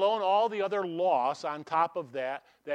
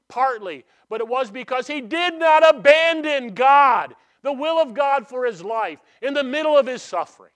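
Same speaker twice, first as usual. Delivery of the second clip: louder, higher preaching at full force; raised, fast, impassioned sermon peak; preaching climax; 7 semitones higher, 11 dB louder than usual. Real, not acted. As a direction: build it up and ring it out.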